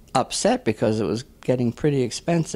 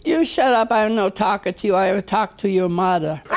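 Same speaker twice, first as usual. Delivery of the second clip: put-on high-pitched scolding with an Italian accent; old Italian lady; 6 semitones higher than usual. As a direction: snap back as a cross old Italian woman. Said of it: "This old Italian lady is praying".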